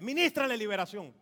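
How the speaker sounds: loud, commanding pulpit order; forceful acted command; shouted order. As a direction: order the usher loudly. Acts pastor ordering the usher.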